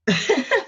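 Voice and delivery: loud laugh; burst of laughter. Real, not acted. Laugh before the phrase.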